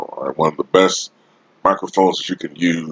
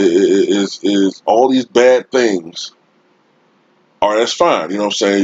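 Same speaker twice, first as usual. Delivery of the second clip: usual speaking voice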